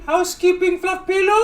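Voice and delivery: mock maid voice. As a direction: pipe it up like a timid maid. Quoting movie maid voice.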